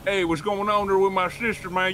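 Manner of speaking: slow, drawled mock American accent; bad American accent. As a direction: imitate a Brit badly faking an American accent. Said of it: "to do an American accent".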